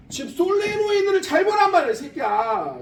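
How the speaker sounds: exasperated loud scold at the students. Real, not acted. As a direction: yell at them to look properly. Teacher scolds students to look.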